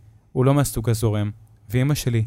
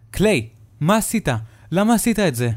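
much higher, louder furious mom; angry mother; 9 semitones higher, 5 dB louder than usual. Acted. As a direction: scold him hard. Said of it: "היא אומרת בכעס". (אמא כועסת וצועקת)

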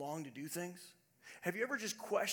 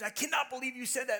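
mock angry wife; 7 semitones higher, 6 dB louder, faster than usual. Wife angrily retold.

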